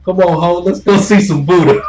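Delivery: high, loud sing-song come-on; mock bar girl call. Imitating a bar girl luring a sailor, high and loud.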